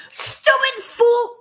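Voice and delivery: loud, drawn-out high retort; going off on someone. Retold or imitated. Wife going off on her husband, loud outburst. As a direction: blow up at him, high and slow. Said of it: "I went off on him".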